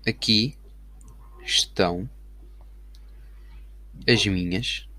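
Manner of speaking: slow, quiet dictation, word by word; slow dictating. Shown as a demonstration. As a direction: spell it out slowly while typing.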